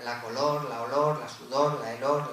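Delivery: loud stressed examples of the feminine article; emphatic examples. Shown as a demonstration. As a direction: hit each "la" noun hard like a list of exhibits. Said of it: "las terminas en or".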